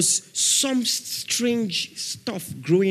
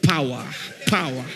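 hitting the mic; 3 semitones lower than usual. Retold or imitated. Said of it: "to be hitting the mic".

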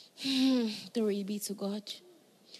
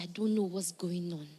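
hushed, unvoiced whisper; mock whispered worry; whispered plea; 7 semitones lower, 5 dB quieter than usual. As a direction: whisper it like a scared confession. Retold plea to pastor, whispered.

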